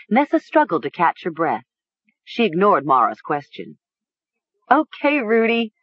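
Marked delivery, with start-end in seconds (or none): 4.7-5.7 high giggly sputter; sputtering laugh. A narrator's reading. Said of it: "she sputtered".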